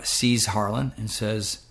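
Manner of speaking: ordinary delivery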